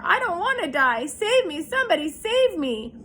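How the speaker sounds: high, loud, frantic pleas; panicked pleading. A narrator's reading. Terrified pig begs someone to save him, high and loud. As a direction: beg for rescue in panic. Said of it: "screamed Wilbur".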